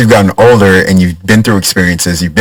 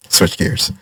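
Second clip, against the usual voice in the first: whispered aside; 9 dB quieter than usual. Real, not acted. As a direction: whisper it with a laugh. Playful aside.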